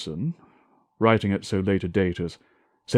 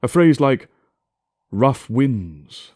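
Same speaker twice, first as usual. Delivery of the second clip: usual speaking voice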